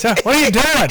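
put-on cranky old codger scolding; old man voice. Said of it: "The old man voice".

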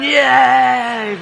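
crowd cheer. Acted. Berlin crowd cheers the president, mock excitement. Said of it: "the people in Berlin must have gone".

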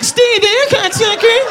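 high squeaky little yell; squeaky doughboy shout. Acted. Doughboy yelling at a friend, a high squeaky shout. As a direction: shout it like a tiny dough creature. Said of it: "The Pillsbury Doughboy's like".

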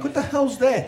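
startled. Retold or imitated. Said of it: "I'm like".

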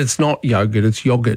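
first "yogurt" YOH-gurt, second "yogurt" YOG-urt; corrective pronunciation contrast. Retold or imitated. Host retelling English person's correction, contrasting both yogurts. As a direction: reject long-O "yogurt", insist on short-O "yogurt". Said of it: "She said".

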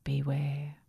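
low eerie whisper; whispering wind. Acted. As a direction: breathe it like the wind. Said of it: "Only the wind seemed to whisper".

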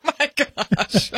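slow laughing oh my gosh. Real, not acted. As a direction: laugh through the words. Laughing at drink order.

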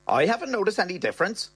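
put-on brisk girlfriend's voice; Bernie's voice.

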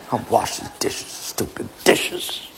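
slow whispered complaint; whispered grumble. Acted. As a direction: hiss the complaint under your breath.

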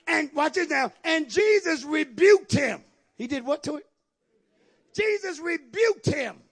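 loud, slow pulpit proclamation; ringing scripture shout; emphatic preaching. Real, not acted. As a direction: drive the verse home to the church. Preaches at full force.

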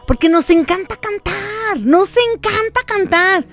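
high, excited gush; gushing enthusiasm. Real, not acted. Host telling listeners why she loves concerts, gushing enthusiasm. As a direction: raise the pitch and gush with delight.

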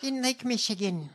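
high, whispery mock anecdote voice; hammed-up, hushed high imitation; over-the-top politician parody. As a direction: parody her, high and airy. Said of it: "Tone it down, please. You sound insane".

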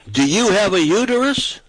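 mock woman's voice. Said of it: "she says".

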